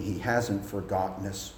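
ordinary delivery

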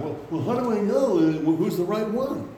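high-pitched imitation of unsure girls; girls' worried questioning. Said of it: "'Cause our girls are kind of".

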